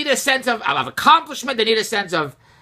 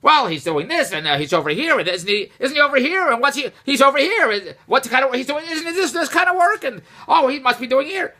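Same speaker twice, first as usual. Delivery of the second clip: imitating Andrea; 6 semitones higher than usual. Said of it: "like a ventriloquist act".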